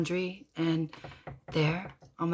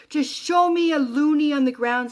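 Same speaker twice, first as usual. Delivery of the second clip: loud, raised, insistent plea; retold inner scream; 8 semitones higher, 6 dB louder than usual. Widow begging Jeremy for a sign, screaming inwardly. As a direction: demand it higher and louder. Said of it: "I scream in my head".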